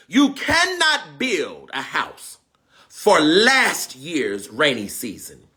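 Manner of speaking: slow, drawn-out proverb; slow deliberate reading. Real, not acted. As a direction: stretch every word. Proverb read slowly.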